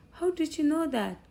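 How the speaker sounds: high, amazed "How" up top; surprised question. Retold or imitated. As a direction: caught off guard a second time. Dad surprised again.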